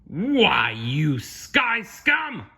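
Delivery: loud, high, drawn-out outrage; angry pirate shout. Acted. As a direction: curse the birds, stretching the words. Pirate swats at thieving seagulls.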